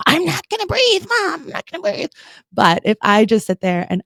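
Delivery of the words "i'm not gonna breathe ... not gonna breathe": put-on high, pouty little-kid refusal; defiant kid. Mom imitating her defiant kid refusing to breathe, high and pouty. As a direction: mimic a stubborn child digging in. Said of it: "my kid will be like".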